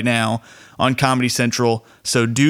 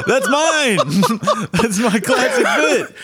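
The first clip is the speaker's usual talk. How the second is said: laughing high while mock-claiming the bit; laughing mock outrage; 11 semitones higher, slower than usual. Real, not acted. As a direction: squeal it out through laughter, higher.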